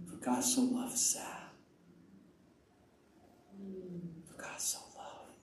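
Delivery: barely audible whispered scripture; murmured prayer. Retold or imitated. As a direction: whisper it privately over each person. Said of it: "I said".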